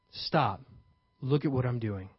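usual speaking voice

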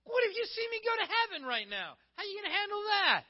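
high, very loud challenge; confrontational challenge. Acted. Jesus confronts grumbling followers who took offense, loudly challenging. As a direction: throw the question at them at full voice.

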